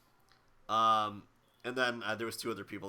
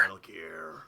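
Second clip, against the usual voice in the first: low, whispered soldier impression; deep whispered game-hero voice; Solid Snake; 6 semitones lower, slower than usual. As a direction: echo it in a low, rough whisper. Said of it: "doing the Solid Snake voice".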